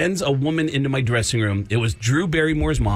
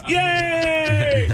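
high, bright fake cheer; mock cheer; 13 semitones higher than usual. Friends on the couch cheering [name] on, high mock cheer. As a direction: cheer him on goofily. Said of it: "we're cheering on [name]".

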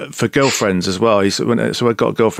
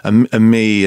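ordinary talk

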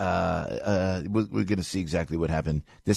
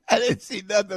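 a higher, lightly hammed Italian tough-guy "I didn't see nothing"; mock Italian mob denial; 12 semitones higher than usual. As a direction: play the tight-lipped mob witness. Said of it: "the Italian groundhog said".

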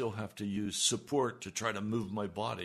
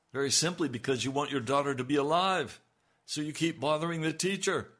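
loud, quick, pressing sermon push; fervent preaching; 5 semitones higher, 7 dB louder, faster than usual. Acted. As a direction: drive the point home with urgency. Urging congregation to persist.

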